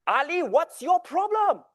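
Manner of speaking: high, loud exasperated snap; angry outburst. Retold or imitated. Tutor snapping at the boy, high and loud. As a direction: let the frustration burst out. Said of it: "I flip".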